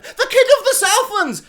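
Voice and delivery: high, loud heroic announcement with a laugh; hammed-up royal fanfare shout; mock grand proclamation. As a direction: bellow it like a pompous herald. Repeating the mocked announcement.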